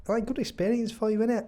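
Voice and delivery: half-managed Liverpudlian salesman; attempted Liverpudlian accent. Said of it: "I can't really do it".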